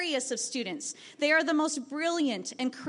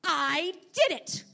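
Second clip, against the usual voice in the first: higher, slow, triumphant declaration; triumphant announcement; 6 semitones higher, slower than usual. Retold or imitated. Boy sees the posters and announces victory, slow and triumphant. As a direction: savour the win, stretching each word. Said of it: "he loudly said".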